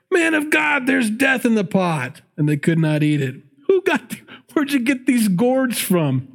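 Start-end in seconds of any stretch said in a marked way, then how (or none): 0.1-2.2 much higher, alarmed shout; student prophet crying out to Elisha in alarm; panicked cry. Acted. Prophet cries out at stew. 3.7-6.3 hungry prophet accusing the cook, high and indignant; squeaky outraged voice. Acted.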